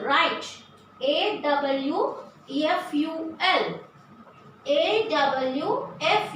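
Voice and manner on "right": ordinary delivery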